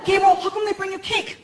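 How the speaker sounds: high-pitched puzzled question; Frankie. Acted. Frankie asking Gabriel about cake, high-pitched. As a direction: ask in a put-on high voice. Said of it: "Frankie walks into the kitchen".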